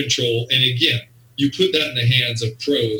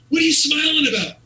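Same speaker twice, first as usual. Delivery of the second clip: squeaky high frantic voice of a flustered owner; panicked owner. Retold or imitated. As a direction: play the boss losing his mind in a shrill squeal. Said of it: "This guy's, like, losing it".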